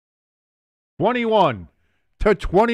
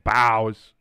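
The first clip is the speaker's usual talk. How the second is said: put-on New Jersey accent; 5 semitones lower than usual. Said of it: "I got that Jersey accent going".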